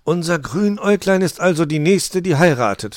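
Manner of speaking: high, loud joyful announcing; delighted announcement. A narrator's reading. Saint Nicholas announcing who marries next, loud and glad. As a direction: proclaim it happily to everyone. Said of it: "verkündete der Nikolaus erfreut".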